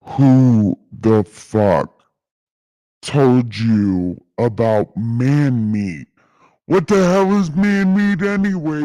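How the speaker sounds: slow, loud, booming outrage; God demanding where Adam heard it; angry God voice. Retold or imitated. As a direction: thunder it out like an offended God. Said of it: "God voice".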